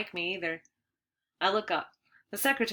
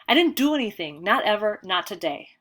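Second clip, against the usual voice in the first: loud protest; 2 semitones higher, 7 dB louder than usual. A narrator's reading. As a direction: insist hard, near yelling. Boy protesting again.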